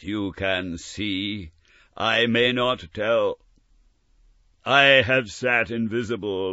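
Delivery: solemn spectral confession; solemn ghost. Acted. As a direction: speak gravely as a spirit. Ghost speaks.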